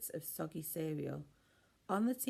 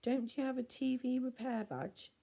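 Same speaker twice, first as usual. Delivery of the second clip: high, pushy demand; 8 semitones higher than usual. A narrator's reading. Ella demanding Norman fix the TV, pushy and high. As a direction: demand it impatiently. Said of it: "she demanded".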